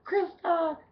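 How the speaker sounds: high, squealed name; starstruck squeal. Retold or imitated. Bartender answering a celebrity, high starstruck squeal. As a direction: squeal your own name like a starstruck fan. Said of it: "I'm like".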